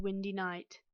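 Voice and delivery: ordinary delivery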